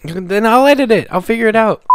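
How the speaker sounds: high, loud retort; exasperated. Real, not acted. Host takes over editing from tired co-host, exasperated. Argues about who will edit.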